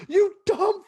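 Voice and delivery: very high, loud, stretched-out shout; movie-quote yell. Retold or imitated. Quoting an angry driver from a film, drawn-out mock yell. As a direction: imitate a movie driver yelling, slow and high.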